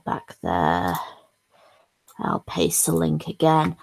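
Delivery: ordinary talk, nothing marked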